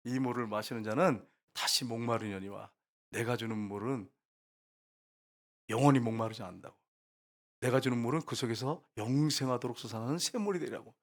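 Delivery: soft, measured voice of Jesus; solemn promise. Retold or imitated. Jesus offering living water to the woman, solemn and soft.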